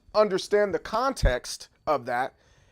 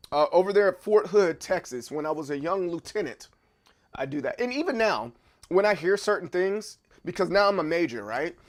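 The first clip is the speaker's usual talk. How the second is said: ordinary delivery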